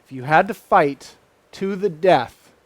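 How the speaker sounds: ordinary talk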